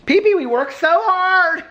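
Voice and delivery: very high, slow squeaky dog voice; voicing the dog. Owner voicing her dog, sighing over their hard life, in a squeaky voice. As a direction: speak for the little dog in a tiny high voice.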